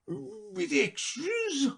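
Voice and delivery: very high, soft and slow; a worried creature fishing for reassurance; a touch of anxious hope; a little anxiously. Acted. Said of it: "a little anxiously".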